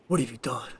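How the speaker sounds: low, brooding Batman line. Retold or imitated. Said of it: "he doesn't really go higher until".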